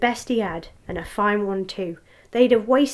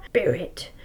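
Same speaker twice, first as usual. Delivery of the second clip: plain reading voice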